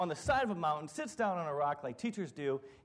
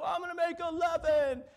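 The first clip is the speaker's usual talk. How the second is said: higher mock shout; mock pumped; 11 semitones higher than usual. Coworker answers the manager, hammed-up shout. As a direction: call it out like a pumped rookie. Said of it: "psych us up".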